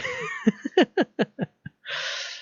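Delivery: a very high, airless giggle after his joke; real amused laugh; breathy high laugh. Real, not acted. Laughing at own bro joke.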